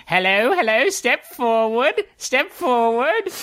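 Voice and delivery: high, drawn-out hawker's call; high-pitched dwarven vendor calling out; dwarven food-booth hawker. Acted. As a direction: beckon customers over in a high, stretched voice. Barker at food booth calls out.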